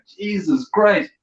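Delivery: hammed-up outrage at a vending machine; mock outrage. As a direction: play shocked at the price. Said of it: "you're like".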